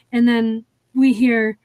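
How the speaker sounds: normal talking voice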